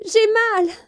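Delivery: high, loud cry of pain; a young princess crying out in pain. A narrator's reading. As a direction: cry out from a throbbing tooth. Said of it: "cria Élisabeth".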